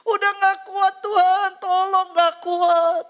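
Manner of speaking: high, slow wailing cry, hammed-up; screaming in pain. [name] screams in pain to God, high wailing cry. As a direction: wail out the pain, drawn out. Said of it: "Saya menjerit".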